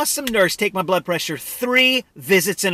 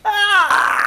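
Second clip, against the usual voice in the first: pained laughing cry; 11 semitones higher than usual. Real, not acted. Cuff squeezing again.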